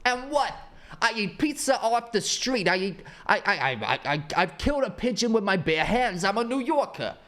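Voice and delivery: high, mocking New Yorker bravado; brash New Yorker. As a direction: puff up like a proud New Yorker. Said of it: "their personality is".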